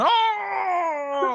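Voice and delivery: high excited shout of Oh!; high-pitched mock whoop; hype man whoop. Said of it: "he comes running in going".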